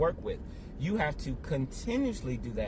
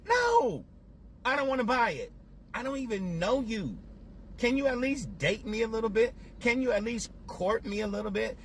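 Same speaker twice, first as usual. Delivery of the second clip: indignant refusal; 7 semitones higher, faster than usual. Pretend. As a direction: play the annoyed customer brushing off a seller. Rejecting pushy sales pitch.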